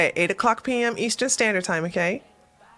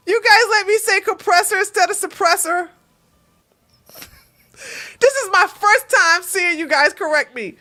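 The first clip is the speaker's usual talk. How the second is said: high, loud incredulous protest; amused outrage; 8 semitones higher, 5 dB louder than usual. Real, not acted. Streamer scolding viewers for not correcting them, amused outrage. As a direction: protest in worked-up disbelief.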